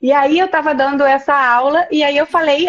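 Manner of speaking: usual speaking voice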